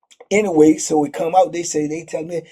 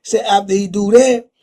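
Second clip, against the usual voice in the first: normal talking voice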